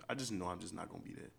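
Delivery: ordinary talk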